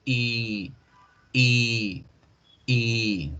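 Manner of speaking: "y" as a drawn-out ee; "y" stretched long with a neutral mouth. Shown as a demonstration. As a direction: hold the ee of "y" about three beats. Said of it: "vamos a alargarla".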